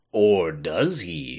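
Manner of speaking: slow, ominous hammy question; bad acting. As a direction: drag it out like a cheesy plot twist. Mock ominous twist on the story.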